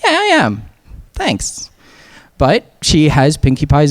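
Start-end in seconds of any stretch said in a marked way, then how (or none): 0.0-1.7 chirpy reply. Retold or imitated. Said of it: "And she was like".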